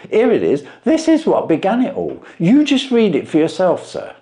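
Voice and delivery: a plain man dumbfounded by the trick; Wilson voiced.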